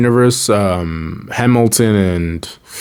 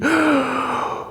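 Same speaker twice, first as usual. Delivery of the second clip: high dramatic sigh; 7 dB quieter than usual. Real, not acted. Fan after sad scene.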